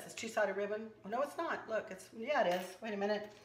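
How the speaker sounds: normal talking voice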